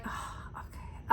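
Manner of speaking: a breathy, resigned "oh, okay"; whispered deflation. Retold or imitated. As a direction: let the air out of it, defeated. Deflated reply on hearing it's closed.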